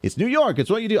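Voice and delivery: badly faked New York accent. Host mimics Trump's defenders saying it's a New York thing, bad New York accent. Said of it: "I don't have the accent".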